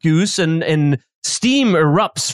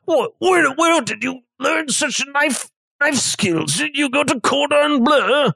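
higher voiced chef, amazed; impressed chef; 8 semitones higher than usual. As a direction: play the chef marveling at the knife work.

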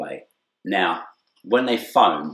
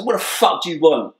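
higher, louder, confrontational snap; irritated shout at the stranger; angry retort; 10 semitones higher, 6 dB louder than usual. Retold or imitated. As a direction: spin round and snap at him. Turning round angrily to face him.

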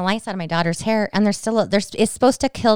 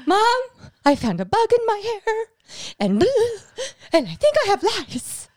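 high-pitched tearful wail, imitating a panicked child; mock hysterical crying, pitched way up; hysterical daughter. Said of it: "my daughter comes into my room and she's hysterical".

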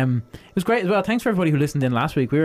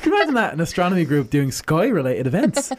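high mock wonder, teasing; sarcastic amazement; 7 semitones higher than usual. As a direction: act amazed at the obvious. Mock amazement at obvious idea.

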